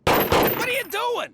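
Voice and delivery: high, loud, drawn-out alarm; shocked, slow demand. Acted. Catches him doing something alarming.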